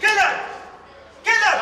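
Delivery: loud, high, sharp call of his name; angry shout of name. Acted. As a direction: stop him in his tracks. Wife scolding Caleb.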